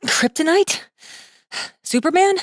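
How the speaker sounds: can't believe he doesn't know; incredulous girl. Acted. Girl amazed Masaaki doesn't know Superman, high and rising.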